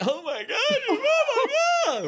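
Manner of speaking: hammed-up very high, drawn-out shriek; panicked scream. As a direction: shriek it in mock agony, stretched out. Retold panic at broken penis.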